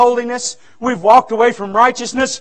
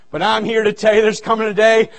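usual speaking voice

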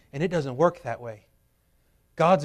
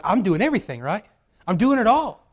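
frustrated; 5 semitones higher, 5 dB louder than usual. Retold or imitated. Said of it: "We get so frustrated going".

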